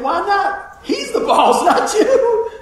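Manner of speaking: high, loud, laughing outburst; laughing exclaim. Retold or imitated. Preacher tells the congregation his thought, laughing loud and high. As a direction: blurt it out through laughter. Retold inner reply to boss.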